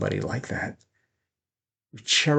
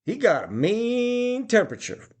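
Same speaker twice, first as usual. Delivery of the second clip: mock quoted saying; 13 semitones higher than usual. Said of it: "we learned the expression".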